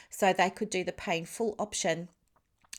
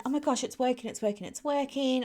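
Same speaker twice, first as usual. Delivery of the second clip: higher, delighted self-talk; thrilled; 6 semitones higher than usual. Retold or imitated. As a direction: celebrate that the launch is working. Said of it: "dancing around thinking".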